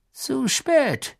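high, thin-voiced regret; resigned. A narrator's reading. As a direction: give up with a sigh in the voice. Witch shakes head, it's too late.

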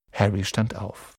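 usual speaking voice

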